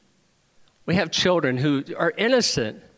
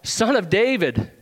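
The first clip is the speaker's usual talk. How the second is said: higher, slow retold cry; calling out; 6 semitones higher, 4 dB louder, slower than usual. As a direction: imitate blind beggars calling out, drawn out. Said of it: "the blind are calling out".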